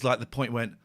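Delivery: usual speaking voice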